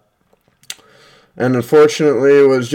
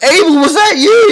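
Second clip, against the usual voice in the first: high, loud accusing call; 6 dB louder than usual. Retold or imitated. Classmates calling out to Abel in a high, loud accusing voice. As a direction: demand to know who did it.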